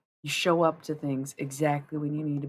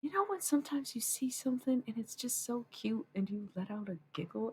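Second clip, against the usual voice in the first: higher, softer sweet partner voice; quoting partner; 8 semitones higher, 7 dB quieter than usual. Retold or imitated. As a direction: lightly voice him, soft and sweet. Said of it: "one time he's like".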